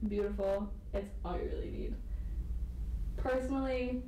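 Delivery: usual speaking voice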